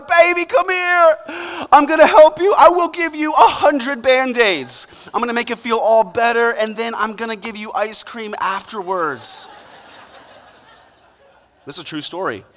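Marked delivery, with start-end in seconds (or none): 0.1-9.5 tender comforting. Retold or imitated. Loving parent comforting crying daughter.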